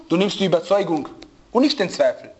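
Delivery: ordinary talk, nothing marked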